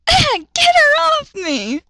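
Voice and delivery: very high, slow hammed-up scream; comic high-pitched panicked scream. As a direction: shriek as she bites your head. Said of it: "he screamed".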